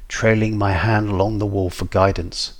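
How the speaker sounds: ordinary delivery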